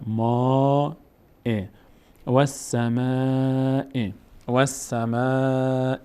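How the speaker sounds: "ما" held long in "و السماء". Shown as a demonstration. Teacher shows how alef lengthens fatha, long held aa in "السماء". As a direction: stretch the aa of "السماء", slowly, before the final sound.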